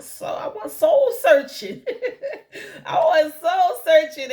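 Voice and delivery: laughing loudly through soul searching. Real, not acted. Telling her viewers about soul searching, laughing loudly. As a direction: crack up at your own words.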